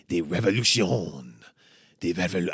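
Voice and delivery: mock-grand French accent, declaimed; dramatic French-accented proclamation. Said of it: "in a French accent".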